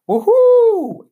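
loud, very high woohoo; long, soaring cheer; jubilant whoop. A narrator's reading. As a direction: whoop it out at full joy. Aldo jumps up overjoyed.

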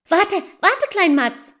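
put-on high, loud eager girl; calling. Asa calls her brother to wait, high and loud. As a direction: stop him short, excited. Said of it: "rief Asa".

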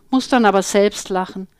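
ordinary delivery

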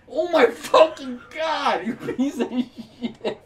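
drawn-out, loud exasperation giving way to laughter; exasperated. Real, not acted. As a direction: drag out every curse in disbelief. Exasperated at partner's game mistake.

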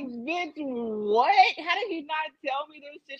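stunned friend. Retold or imitated. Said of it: "her friend was like".

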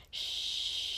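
long soft hissing shush; slow, sustained "shh"; hissed shush. Acted. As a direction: hush them with a drawn-out hiss. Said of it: "brought one finger to his lips and hissed".

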